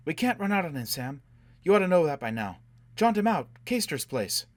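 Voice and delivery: high, loud insistent pleading; urgent insistence. A narrator's reading. Insisting Quat carry Foyle away.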